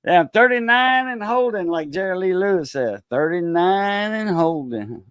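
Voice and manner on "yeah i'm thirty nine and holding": normal talking voice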